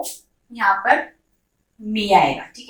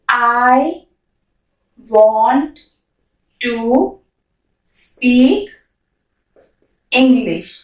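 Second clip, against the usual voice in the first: each word spoken separately with long gaps; slow dictation pace; 3 dB louder, much slower than usual. Shown as a demonstration. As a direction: spell it out one word at a time, pausing between. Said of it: "word-by-word model sentence".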